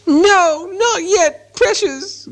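very high creature whine; Gollum voice. A narrator's reading. Gollum puts Bilbo off, whining high. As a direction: refuse in a high, thin creature voice.

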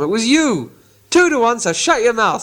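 two against one, shut him up; bullying troll. Acted. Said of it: "said Bert".

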